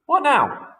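high, loud squawking question; a startled little bird asks what's next; roly-poly bird voice. Acted. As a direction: bird asking, breathless and high. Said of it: "said the roly-poly bird".

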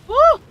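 high, loud "oh"; startled yelp. Real, not acted. Player watching the fight go wrong, startled yelp. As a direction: yelp as the hit lands. Reacting to a hit in game.

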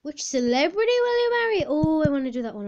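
high, fast excited squeal; gushing with sudden excitement. Real, not acted. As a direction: burst out loud and high, thrilled at the find. Spots the quiz she wants.

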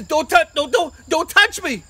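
loud, high stammered warning; acted alarm, tripping over the words; panicked warning. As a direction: urgently fend off a hug. David fends off his children.

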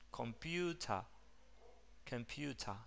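"Computer" with a soft schwa "-ta" and no R; schwa ending. Shown as a demonstration. Teacher modelling the final schwa to students. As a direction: end on a short, quiet "uh". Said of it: "el sonido de la schwa reemplaza".

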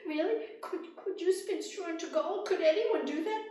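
high, loud eager asking; hopeful young woman. Acted. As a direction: plead in amazement. Rose asks the little man.

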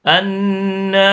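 "อัน นะ" with a long hummed n; delay "นะ" by humming through the n; held nasal sound. Shown as a demonstration. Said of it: "หน่วงเสียงนะครับ".

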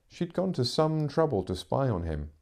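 plain reading voice

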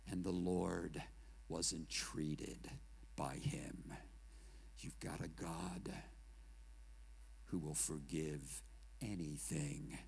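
hushed preaching. Acted. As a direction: draw the room in close with a near-whisper. Repentance prayer, hushed.

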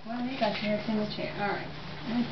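ordinary talk, nothing marked

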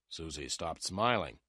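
ordinary delivery, nothing marked

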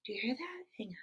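plain reading voice

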